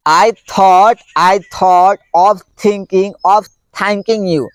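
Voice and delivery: very slow, each word apart; slow tongue twister. Shown as a demonstration. Teacher demonstrating a th tongue twister slowly. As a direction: model it slowly. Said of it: "क्या है?".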